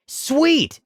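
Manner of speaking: high gleeful yelp; a quick thrilled cheer; excited kid. Retold or imitated. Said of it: "the kid says with a little fist pump".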